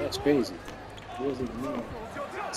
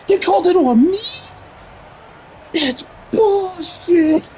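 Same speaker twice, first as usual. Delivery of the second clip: outraged complaint; 3 dB louder than usual. Real, not acted. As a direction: protest the call in disbelief. Disputing a foul call.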